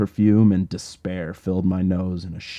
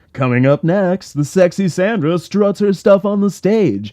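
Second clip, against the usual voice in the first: higher, louder hyped announcer voice; strip club DJ; 8 semitones higher, 6 dB louder than usual. Acted. DJ announcing the next dancer to the club, hyped announcer voice. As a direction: sell it like a cheesy club DJ. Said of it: "familiarly irritating voice of a strip club D J".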